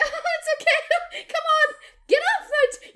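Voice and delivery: high, loud forced laughing coax; forced jolly. Retold or imitated. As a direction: laugh it off high and bright while bleeding. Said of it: "keep it nice, jolly, merry".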